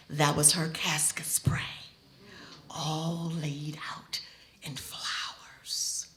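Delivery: soft, low, slow whisper. Real, not acted. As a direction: hush it like a sacred reveal. Hushed reveal of casket spray.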